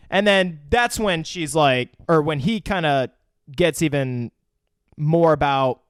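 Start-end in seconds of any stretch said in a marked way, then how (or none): none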